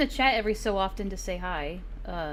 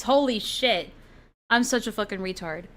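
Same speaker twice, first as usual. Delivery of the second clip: usual speaking voice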